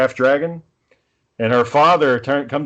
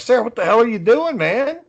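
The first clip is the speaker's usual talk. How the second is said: higher, exasperated cry at a teammate; pitched-up, fed-up plea to a friend; exasperated disbelief; 9 semitones higher than usual. Retold or imitated. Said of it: "And I'm like".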